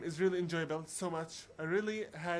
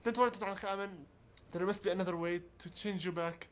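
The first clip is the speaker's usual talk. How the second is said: ordinary talk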